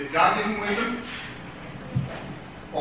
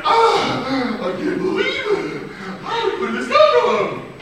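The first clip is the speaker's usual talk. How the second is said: put-on delighted girl; 12 semitones higher, 4 dB louder, slower than usual. Girl finds dollhouse.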